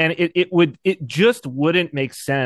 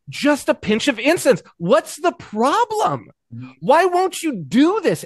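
mock-pleading governor, much higher and slow; exasperated governor; 9 semitones higher, slower than usual. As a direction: whine it like a fed-up Roman governor. Governor pressing a saint, acted.

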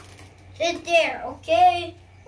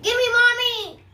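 high, loud demand; insist, pushing harder; insistent demand; 7 semitones higher, 6 dB louder than usual. Real, not acted. Child demanding the mommy toy from her sister, loud insistent demand.

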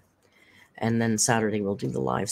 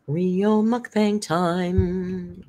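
slow announcer; 4 semitones higher, much slower than usual. Real, not acted.

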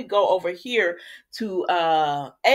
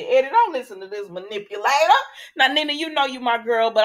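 calling out; 4 semitones higher, 6 dB louder than usual. Pretend. Calling out character.

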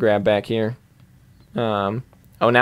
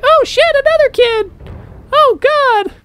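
very high, loud cartoon squeal; panicked kid; 5 dB louder than usual. Gamer imagines the kid spotting another child, squealing panic. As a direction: shriek it like a startled kid. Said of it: "he's like".

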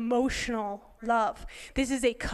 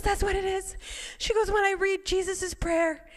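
high-pitched, eager rush of feeling; retold excitement; 8 semitones higher than usual. Speaker quoting her very excited friend, high-pitched. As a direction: quote her as she gushes, high. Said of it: "she's so excited".

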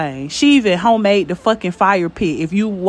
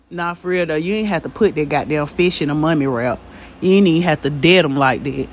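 ordinary talk

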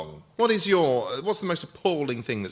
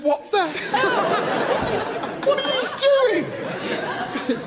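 drawn-out, much higher, laughing disbelief; friends' disbelief, laughing; much slower than usual. Retold or imitated. Friends in the car asking what he'd just done, laughing and drawn out. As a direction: stretch it out, cracking up with shock. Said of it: "my friends who were all going".

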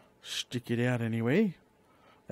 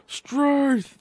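high, loud exclamation of dismay; exasperated; 12 semitones higher, 5 dB louder than usual. Real, not acted. Gamer whose build is not working out, exasperated. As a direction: exclaim in frustration.

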